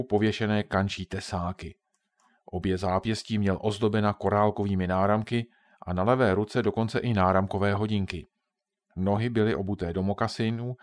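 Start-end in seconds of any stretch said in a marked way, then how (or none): none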